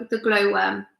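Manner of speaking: ordinary delivery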